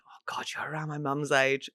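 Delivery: hushed, near-whisper aside; whispered realisation. Retold or imitated. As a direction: whisper it as a private thought, retold. Retold private realisation.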